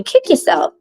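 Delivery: very high mocking falsetto; mocking the bullies in a high squeaky voice. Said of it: "other people will teach you".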